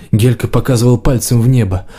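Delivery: ordinary delivery, nothing marked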